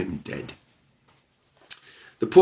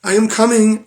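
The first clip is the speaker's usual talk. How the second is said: panicked reply; 13 semitones higher, 5 dB louder than usual. Acted. Wife answers husband in panic.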